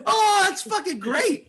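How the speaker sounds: high, slow, laughing cry of joy; laughing delight. Real, not acted. Comedian reacting to a friend's story, laughing hard. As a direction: crack up while praising the joke.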